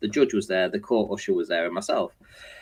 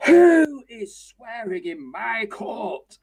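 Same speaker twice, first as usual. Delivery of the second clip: slow, high, stern judge demand; mock judge asking who swore in court; outraged judge; 11 semitones higher, 5 dB louder, much slower than usual. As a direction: boom it from the bench, offended. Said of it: "The judge goes".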